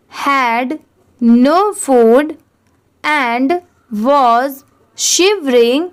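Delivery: very slow, word-by-word reading with long pauses; slow model reading. Shown as a demonstration. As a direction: read it out slowly so the class can repeat each word. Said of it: "आप मेरे बाद ज़रूर repeat करिए". (बहुत धीमे, रुक-रुककर पढ़कर सुनाना)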